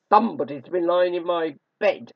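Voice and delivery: loud, great growling bear voice; gruff, growling Father Bear. A narrator's reading. Father Bear finding his bed disturbed, gruff growl. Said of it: "gruff, growling voice".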